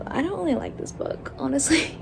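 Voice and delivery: usual speaking voice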